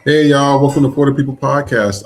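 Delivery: loud, energetic host welcome to the audience; hyped show opener. Real, not acted. Host greeting the listeners at the start of the show, loud and hyped. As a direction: open the show big, like calling the room to order.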